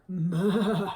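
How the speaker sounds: loud, wavering bleat; goat bleating. A narrator's reading. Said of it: "said Goat".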